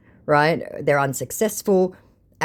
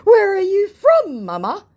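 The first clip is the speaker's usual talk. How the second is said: much higher, slow, louder scammer; sweet-talking scammer; mock scammer voice; 7 dB louder, slower than usual. Scammer asking the vlogger again, slow mock voice. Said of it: "To which he says".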